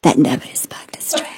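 soft, breathy mock whisper. Host whispering sarcastically about the drink. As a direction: hush it like a secret. Mocking hushed aside.

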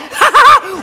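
short, loud, high burst of laughter; mock her uncontrollable laughing; imitated cackle. Imitating girlfriend's laughing fit.

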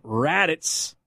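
drawn-out, deliberate name; slowly, carefully. Real, not acted. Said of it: "I gotta say it slow".

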